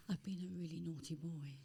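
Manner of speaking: very soft, like a sheepish confession; hushed confession. Retold or imitated. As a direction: drop to a soft, guilty near-whisper. Footballer confessing mischief.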